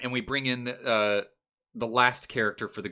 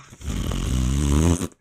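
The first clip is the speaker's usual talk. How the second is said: laugh; 11 semitones lower, 7 dB louder than usual. Real, not acted. Laugh after fart joke.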